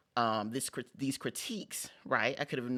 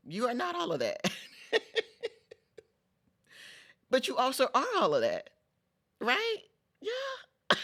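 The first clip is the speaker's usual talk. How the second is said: much higher, laughing "you are not all of that"; playful laughing tease; 12 semitones higher, 4 dB louder than usual. Real, not acted. Vlogger teasing viewers about their ego, high and giggly.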